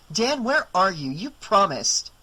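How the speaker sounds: a hint of hurt accusation; upset wife.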